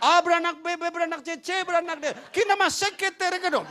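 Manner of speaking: high, loud mock tongues; bahasa roh tiruan. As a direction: turn the genealogy into fervent pretend tongues. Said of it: "bahasa roh saya baca Alkitab". (bahasa roh tiruan, nyaring dan tinggi)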